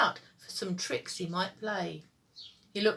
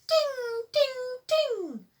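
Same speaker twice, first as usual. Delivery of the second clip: very high, sung ding ding; much slower than usual. A narrator's reading. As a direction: chime it like a tiny bell. The mystery bell sound.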